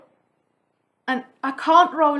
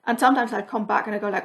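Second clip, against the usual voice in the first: ordinary talk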